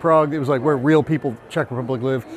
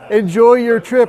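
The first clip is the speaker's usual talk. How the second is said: imitated Flemish accent, pitched up; 5 semitones higher, 4 dB louder than usual. Hostel woman bids departing guest goodbye, Flemish accent. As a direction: put on a sudden Flemish accent. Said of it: "Flemish accent out of nowhere".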